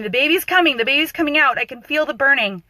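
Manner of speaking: high, urgent imitation of screaming; retold scream. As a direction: call out like a labouring mother, easing slightly. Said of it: "she starts screaming".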